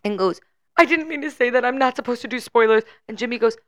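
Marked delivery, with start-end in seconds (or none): 0.8-2.8 high-pitched apologetic imitation; imitating flustered guest. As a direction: act out a panicky guest apology. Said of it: "on the verge of tears".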